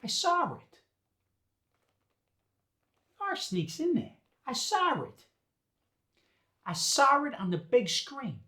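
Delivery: Boston intrusive R. Shown as a demonstration. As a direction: sneak an R into "saw it", high-pitched Boston. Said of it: "we throw in an R".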